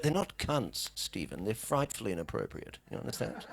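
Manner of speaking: put-on posh British accent. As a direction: correct him like an unflappable toff. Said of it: "the accent helps him".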